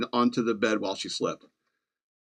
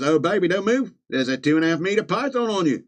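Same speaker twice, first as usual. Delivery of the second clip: higher put-on Australian accent; comic Aussie husband; mock Australian warning; 5 semitones higher than usual. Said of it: "doing an accent".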